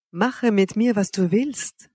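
ordinary delivery

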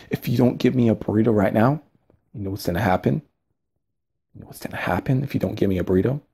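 a touch of hammed-up menace; mock threat. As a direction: hush it like a cartoon threat. Mock threat over burrito.

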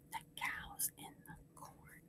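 barely audible whisper; whispered echo. Shown as a demonstration. Teacher leaves room for class to repeat, whispering faintly.

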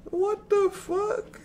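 normal talking voice

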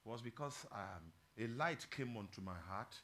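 soft, low, earnest preaching; quiet confession. Real, not acted. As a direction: confide it gently to the congregation. Quiet personal confession in sermon.

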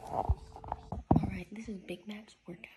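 soft, quick whisper. Real, not acted. Whispering the intro to Big Mac's workout.

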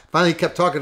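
ordinary delivery

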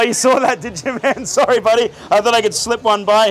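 usual speaking voice

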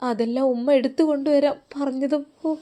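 usual speaking voice

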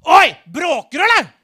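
loud, high mock-angry yell; acted shout, picking a fight; angry man shouting. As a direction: bellow it like a furious little man. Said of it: "en sint liten mann løpende over gata som roper".

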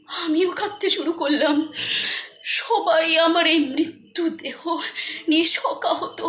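ordinary talk

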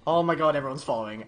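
ordinary delivery

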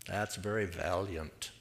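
low, quiet ironic aside; understated sarcastic remark; dry sarcasm. Real, not acted. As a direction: say it with a dry, knowing tone. Dry irony about hiding Gideon.

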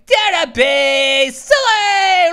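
slow loud drawn-out shout; shouted catchphrase. Real, not acted. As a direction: belt out the sign-off. Catchphrase outro.

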